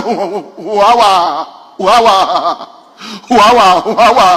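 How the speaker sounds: comic stammer with drawn-out hoots. Acted. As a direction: get stuck on the word, stretching the vowels. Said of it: "My friend happened to be a stammerer".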